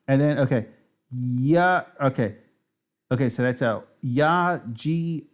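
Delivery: normal talking voice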